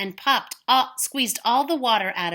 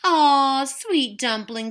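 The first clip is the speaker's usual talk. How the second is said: cooing; 5 semitones higher, 4 dB louder, slower than usual. Acted. Una cooing at baby.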